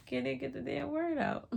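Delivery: real laugh through the words; laughing at herself. Real, not acted. Stumbling over a word and laughing at herself, real laugh. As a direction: laugh it out after fumbling the word. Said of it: "a minisation".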